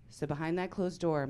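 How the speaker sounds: ordinary talk, nothing marked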